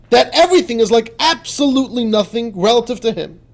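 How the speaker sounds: high, loud, scornful rebuke; furious rebuke. Shown as a demonstration. Rebuking the animal soul for denying God, furious. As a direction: lash out at it in disbelief. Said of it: "So that's where we continue".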